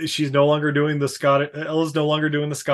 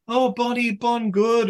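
mock Scottish accent; 8 semitones higher, slower than usual. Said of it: "her Scottish accent".

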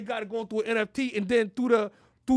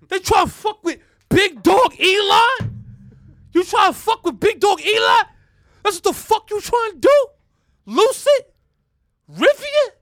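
shouting Elon; 9 semitones higher, 10 dB louder than usual. Pretend. Pretend Elon mocking rival carmakers, high loud boastful shout. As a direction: roar it like the big dog. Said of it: "I don't know if he was talking like that".

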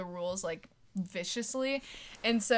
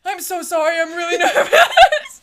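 high, loud, laughing nervous apology; student apologizing to the principal, laughing nervously; 8 semitones higher, 15 dB louder than usual. Retold or imitated. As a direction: apologize through nervous giggles. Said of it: "And I was like".

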